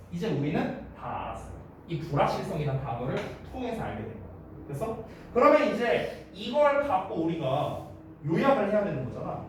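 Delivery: usual speaking voice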